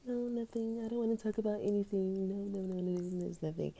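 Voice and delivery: soft deflecting refusal; dismissive quiet. Retold or imitated. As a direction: mumble it away, avoiding. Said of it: "I'd be like".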